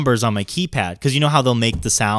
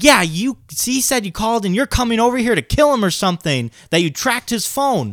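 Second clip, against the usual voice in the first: high-pitched mom on the phone; higher voice, retold accusation; imitated accusing mother; 9 semitones higher than usual. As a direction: act out a mother confronting the caller.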